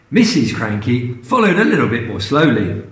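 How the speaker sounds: usual speaking voice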